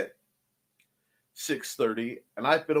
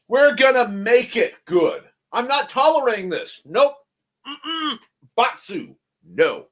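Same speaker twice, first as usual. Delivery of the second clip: higher, louder, firm no; emphatic refusal; 7 semitones higher, 5 dB louder than usual. Pretend. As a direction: put your foot down playfully.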